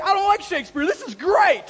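thrilled at the find; excited high outburst; gleeful kid. Retold or imitated. Kid excited finding play.

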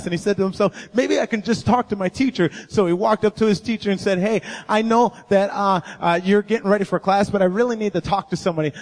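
preaching a story. Acted. Preacher telling the congregation about Nick, in full preaching voice. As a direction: build the story with pulpit energy.

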